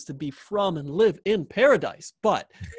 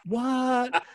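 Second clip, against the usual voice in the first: high startled what, pitched way up; a listener gasping at Joffrey's age, high and surprised; shocked what; 8 semitones higher than usual. Retold or imitated. Host mimics audience shock, high startled what. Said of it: "you'd be like".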